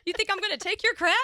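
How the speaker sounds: mock tough racer. Mock defiant racer quote.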